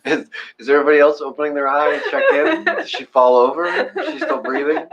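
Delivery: high, fast giggly worrying; laughing. Retold or imitated. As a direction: rattle off the worries laughing. Mock worried inner thoughts.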